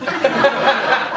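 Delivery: quick bright burst of laughter; brief real chuckle; short laugh. Real, not acted. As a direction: laugh it off with the audience. Laughs again at the crowd.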